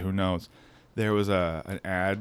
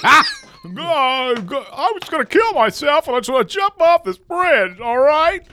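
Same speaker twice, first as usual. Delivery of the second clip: very high, strained, louder stammering voice; Jimmy Stewart impression; 7 dB louder than usual. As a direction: push way up into a frantic old-film stammer. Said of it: "Jimmy Stewart".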